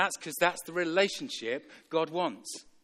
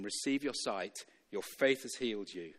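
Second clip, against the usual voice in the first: quiet pronouncement; 7 semitones lower, 8 dB quieter than usual. Acted. Jesus healing the blind man, quiet pronouncement. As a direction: say it gently, dropped right down.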